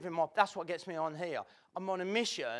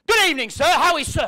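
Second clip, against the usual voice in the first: mock eager salesman, louder and higher; a hint of a trained sales pitch; hammed-up watch seller greeting; pushy salesman; 7 semitones higher, 11 dB louder than usual. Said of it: "been on a sales training course".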